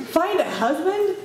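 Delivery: high-pitched, disbelieving question; incredulous echo. Acted. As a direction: throw the words back in disbelief. Incredulous echo of counselor's advice.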